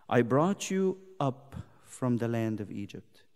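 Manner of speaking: verse said low and soft; quiet pulpit reading; hushed verse. Acted. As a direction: lay the line down gently.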